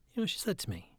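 ordinary delivery

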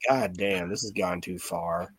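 softly, dropping away to near nothing; a low dismayed mutter as if to himself; quiet mock dismay; muttered aside. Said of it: "looking down the aisle like".

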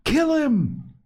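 lost in the hunt's frenzy; frenzied cry. A narrator's reading. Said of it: "carried away by a sudden thick excitement".

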